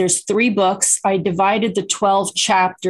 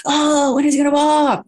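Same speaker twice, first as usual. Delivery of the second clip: high, slightly louder worried parent; high-pitched anxious parent asking; fretting parent; 8 semitones higher, 3 dB louder than usual. Retold or imitated. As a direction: whine like an impatient mom. Impatient parent voiced.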